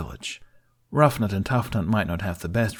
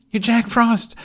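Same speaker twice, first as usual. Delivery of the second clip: high, loud overjoyed shout; a boy crying out as his brother lands; joyful cry; 7 dB louder, slower than usual. A narrator's reading. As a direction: burst out with pure joy. Jamie overjoyed continues.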